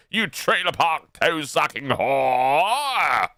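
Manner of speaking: slow, drawn-out roll of insults; theatrical tirade with long wailed endings; mock abusive rant. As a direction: relish each insult, stretching the final word. Theatrical string of insults at Boogie.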